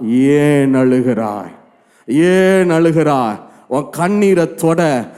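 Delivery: low, very slow quoted voice; weighty scriptural voice, falling at the end; solemn divine question. Retold or imitated. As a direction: draw each word out gravely. Voices Jesus questioning weeping Mary.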